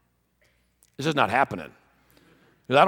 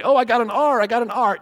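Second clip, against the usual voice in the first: put-on excited kid; 8 semitones higher, 4 dB louder than usual. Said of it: "Ray will say".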